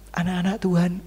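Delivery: ordinary talk, nothing marked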